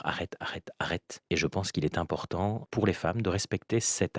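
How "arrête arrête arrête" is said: whispering. Retold or imitated. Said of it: "monsieur va dire".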